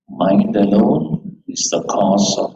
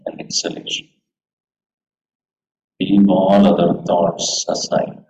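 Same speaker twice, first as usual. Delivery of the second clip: guided meditation. Acted. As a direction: guide them gently into stillness. Leads a meditation.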